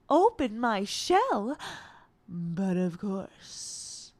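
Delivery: slow, soft, drawn-out cartoon voice; Barbie film character. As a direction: stretch it out softly, trailing into a hush. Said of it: "Am I missing any Barbie ones".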